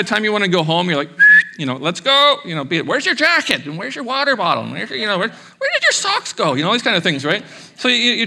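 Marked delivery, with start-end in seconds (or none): 1.8-2.4 put-on calling the kids. Parent calling kids to leave. 2.9-6.5 high, fussy mom rattling off questions; mock busy parent checklist; nagging parent. Parent nagging kids at camp.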